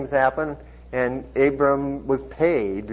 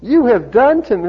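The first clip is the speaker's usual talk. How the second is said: loud, high accusation; dramatic pulpit reading; scripture reproach; 9 semitones higher, 8 dB louder than usual. Acted. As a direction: proclaim the king's reproach. Reads king's reproach from scripture.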